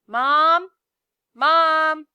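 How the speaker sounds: loud high shout; boy yelling for his mom, loud and high. A narrator's reading. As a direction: call out for your mother across the house. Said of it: "and then yells".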